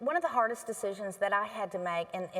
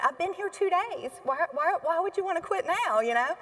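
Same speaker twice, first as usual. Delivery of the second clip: higher, pleading; retold pleading disbelief; 8 semitones higher, 4 dB louder than usual. The new administrator asks the quitting director, mildly pleading. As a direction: ask it in mild disbelief. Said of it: "I said".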